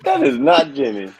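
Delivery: loud laughing disbelief; loud, laughing "what the" surprise. Real, not acted. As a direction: react with laughing shock. Surprised it isn't Jenny.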